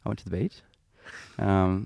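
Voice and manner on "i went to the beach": "I went to the beach" lifted into a question on "beach"; Australian rising intonation. Shown as a demonstration. Demonstrating how everything's a question in Australia, upward intonation. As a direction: make the statement ask, pitch climbing on "beach". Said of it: "everything's a question".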